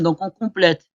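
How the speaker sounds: ordinary talk, nothing marked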